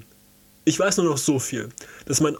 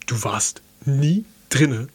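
slow, disbelieving question; a touch of astonished doubt, drawn out; incredulous; 4 dB louder, slower than usual. A narrator's reading. Watson disbelieves Holmes, in slow mild astonishment.

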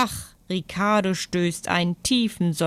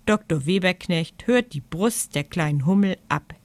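ordinary delivery, nothing marked

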